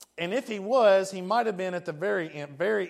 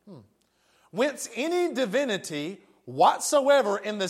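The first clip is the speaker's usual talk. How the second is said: formal reading of a decree; 3 semitones higher, 3 dB louder than usual. Acted. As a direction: read out an edict, weighing each phrase. Reads Constantine's edict aloud.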